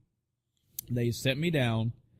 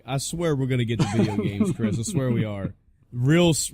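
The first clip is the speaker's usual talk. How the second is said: usual speaking voice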